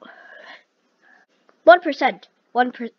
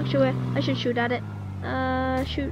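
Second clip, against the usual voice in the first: ordinary talk, nothing marked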